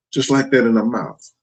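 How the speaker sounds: usual speaking voice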